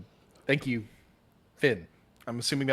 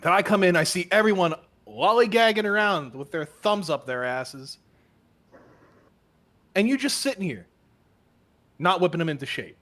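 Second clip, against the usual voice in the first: berating inspector; 3 semitones higher, 4 dB louder than usual. Acted. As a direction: tear into him for his men's state. Inspector berating captain's neglect.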